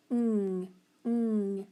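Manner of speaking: "ng" as a held nasal hum; isolated digraph sound. Shown as a demonstration. Teacher quizzing pupils on a digraph, "ng" held as one sound. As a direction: hold "ng" without naming letters. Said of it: "it's digraph N G".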